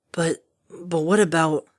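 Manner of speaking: slow, worried faltering; stammering. A narrator's reading. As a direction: stammer in dread. Stammering worry.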